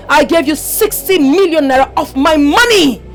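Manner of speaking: forceful, outraged reproach; angry demand. Acted. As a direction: build indignantly toward the last word. Ghost demands her money back.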